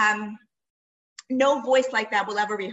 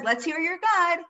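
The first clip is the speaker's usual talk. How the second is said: taunting; 5 semitones higher, 4 dB louder than usual. Retold or imitated. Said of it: "right after Eliyahu says".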